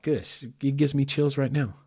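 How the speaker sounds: ordinary delivery, nothing marked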